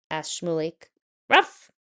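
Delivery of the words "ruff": sharp high loud woof; dog bark. Acted. The dog Ezra answers his owner with a loud bark. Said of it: "barked Ezra".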